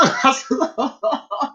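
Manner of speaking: helpless laugh after an impression; laughter. Real, not acted. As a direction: crack up at your own imitation. Cracks up after preacher imitation.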